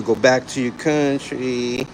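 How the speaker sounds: slowed way down, word by word as if to a foreigner; slow, spelled out. Shown as a demonstration. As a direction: drag out Go back to your country for someone who can't follow. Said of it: "Can't understand you".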